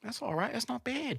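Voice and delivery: high, soft mock British accent; small high British voice. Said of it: "I'll be like".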